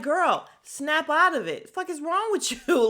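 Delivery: mock yell in a put-on voice; exasperated yell. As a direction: shout at her to wake up. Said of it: "I'm like".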